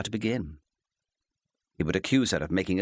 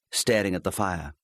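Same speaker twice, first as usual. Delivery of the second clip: ordinary delivery, nothing marked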